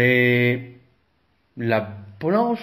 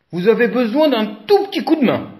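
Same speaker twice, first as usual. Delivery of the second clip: lion voice; 8 semitones higher, 4 dB louder, much faster than usual. A narrator's reading. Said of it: "dit Lion".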